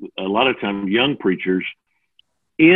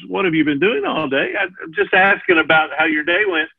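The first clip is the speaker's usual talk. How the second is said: lightly higher, sweet innocent asking; 6 semitones higher than usual. Retold or imitated. Husband repeating his question to his wife, innocently. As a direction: ask it as if it were harmless. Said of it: "repeat that back with a different tone".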